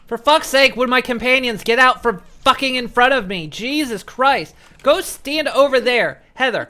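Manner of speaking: loud, exasperated shouting; angry yelling. Real, not acted. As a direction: yell at them in real annoyance. Yelling at companions.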